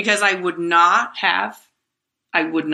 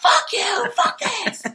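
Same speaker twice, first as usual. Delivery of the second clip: much higher, sudden outburst; shouting at herself; slower than usual. Retold or imitated. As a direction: snap back at your own calm voice with a yell. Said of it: "Like, I'd shout to myself".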